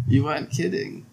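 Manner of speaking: put-on tentative German-accented retry; attempted German accent. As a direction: feel for the old Fraulein's German accent. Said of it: "That's close".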